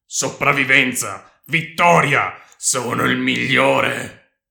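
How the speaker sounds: loud, slow victory cry; triumphant shout. A narrator's reading. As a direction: proclaim it slowly, swelling with triumph. Victor exults over beaten enemy. (grido di trionfo, lento e forte)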